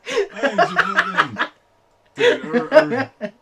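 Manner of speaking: high, loud words through helpless laughter; laughing hard. Real, not acted. Laughing hard mid-story.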